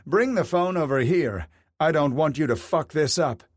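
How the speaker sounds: husband ordering his wife to bring the phone; calling out. A narrator's reading. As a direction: bark it across the room. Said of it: "Burkhardt called out".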